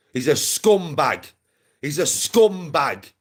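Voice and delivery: slow, drawn-out condemnation; deliberate, weighty repetition; emphatic insult. Real, not acted. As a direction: stretch the insult and drive it home. Condemning a man with repeated insult.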